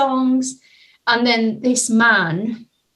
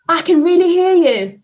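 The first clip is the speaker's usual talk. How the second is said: higher and louder, full of energy; emphatic empathy; 7 semitones higher, 6 dB louder than usual. Retold or imitated. Telling the man she truly hears him, raised and energetic. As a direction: land it with real force.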